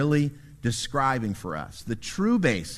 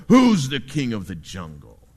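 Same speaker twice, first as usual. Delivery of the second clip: lion voice, louder and lower, booming the question; lion roar; 4 semitones lower, 6 dB louder than usual. Acted. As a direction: roar it like a lion demanding an answer. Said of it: "to this monkey he roars".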